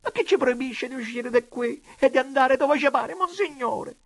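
high, plaintive tearful complaint; high-pitched distressed pleading; tearful protest. A narrator's reading. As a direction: wail the question through tears. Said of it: "tra le lacrime".

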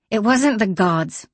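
sharp, curt retort; snapped. Acted. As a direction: bite the words off. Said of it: "she snapped".

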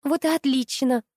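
mildly delighted brush-off; pleased. A narrator's reading. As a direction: seize on it gladly, voice lifted. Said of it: "обрадовалась Нина".

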